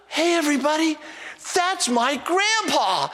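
put-on gasp, then very high kid's holler; excited little boy. Grandson showing grandpa off to his buddies, high excited shout.

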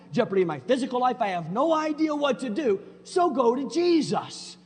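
loud, high, driving preacher voice; preaching at full force. Acted. Pastor driving the point home to church, loud preaching. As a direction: pound it out from the pulpit.

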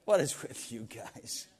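slow, unimpressed drawl; a lazy, amused put-down; bemused Elijah. Retold or imitated.

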